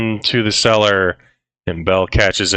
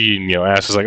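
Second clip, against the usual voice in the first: ordinary talk, nothing marked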